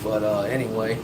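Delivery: ordinary talk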